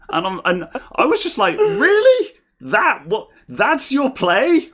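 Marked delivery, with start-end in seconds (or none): 1.6-4.7 very high, loud, drawn-out disbelief; scoffing incredulity. Retold or imitated. Man questioning a scammer's threat to kill him, incredulous. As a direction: squeal the questions in mock astonishment.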